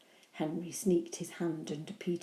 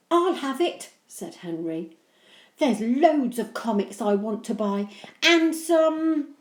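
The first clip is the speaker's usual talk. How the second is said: grabby; 5 semitones higher, 8 dB louder than usual. Acted. Henry grabby.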